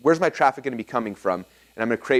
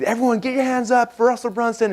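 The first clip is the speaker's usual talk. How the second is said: much higher, booming crowd call; hype-man intro; 11 semitones higher than usual. Retold or imitated. Tony Robbins introducing the speaker on video, big hype call. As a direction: fire up the whole room.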